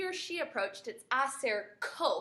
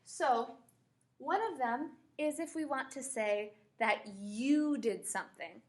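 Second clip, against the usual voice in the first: ordinary delivery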